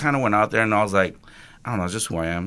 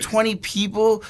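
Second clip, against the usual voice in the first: much higher and slow, mock-exasperated; retold disbelief; 10 semitones higher, slower than usual. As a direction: stretch it out in fed-up disbelief. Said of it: "I was getting pissed".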